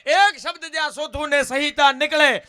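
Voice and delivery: high, very loud folk-poet declamation; જુસ્સાદાર કાવ્યપઠન. Acted. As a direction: proclaim the verse to the crowd at full force. Declaims verse at full force. (જોશભેર, ઊંચા અવાજે લલકાર)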